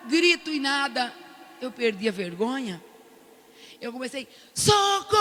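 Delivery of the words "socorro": shout for help. Retold or imitated. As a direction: yell help at the top of the ward. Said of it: "gritar socorro".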